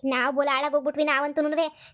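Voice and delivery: ordinary talk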